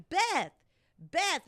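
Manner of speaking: high, hushed-shout call to a friend. Retold or imitated. Retold calling out to friend.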